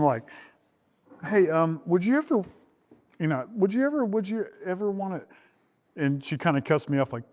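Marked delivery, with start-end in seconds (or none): 1.2-5.2 high, halting, nervous ask; nervous stammer. Retold or imitated. Asking his future wife out, nervously stammering high. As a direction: stammer out the question like a scared teen. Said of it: "I'm so nervous. I'm so scared".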